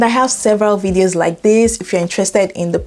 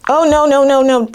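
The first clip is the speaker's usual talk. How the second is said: alarmed protest; 7 semitones higher, 4 dB louder than usual. Real, not acted. Protests when the assistant says goodbye.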